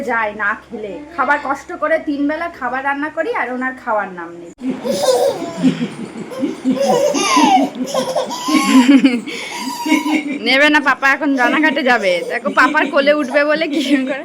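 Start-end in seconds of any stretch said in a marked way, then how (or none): 4.6-10.4 long, high burst of real laughter; loud laugh. Real, not acted. Mother laughs at child. (জোরে হেসে ওঠা)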